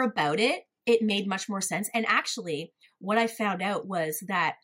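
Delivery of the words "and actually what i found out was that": ordinary talk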